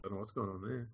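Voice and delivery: faint, far-off voice; quiet mic. Real, not acted. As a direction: sound distant from the mic. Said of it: "you sound real quiet".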